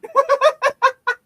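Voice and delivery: shrill burst of laughter; high squealing laugh. Real, not acted. Laughs at the mixup.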